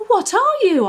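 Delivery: high, loud amazed question; wide-eyed, ask in wonder; astonished. A narrator's reading. Puppy meets the hedgehog, astonished. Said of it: "asked Scamper in astonishment".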